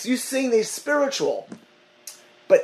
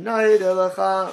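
Neidah Lecha, drawn out very slowly; slower than usual. Retold or imitated. Rabbi retells the great sage's slow, deliberate blessing. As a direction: stretch each word of Neidah Lecha. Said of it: "He said slowly".

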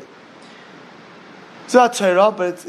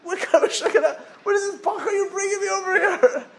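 high and laughing in disbelief; can't believe who he brought; incredulous laugh; 12 semitones higher than usual. Acted. Rabbi laughing in disbelief at the boy he brought.

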